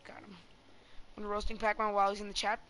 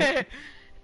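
laugh; 4 semitones higher, 5 dB louder than usual. Real, not acted. Friends laughing in game.

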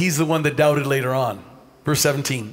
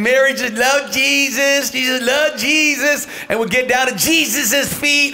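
higher, drawn-out mock adoring tone; mock adoration; 6 semitones higher, slower than usual. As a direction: tease a devoted little sister. Caricature of adoring Mary beside Martha.

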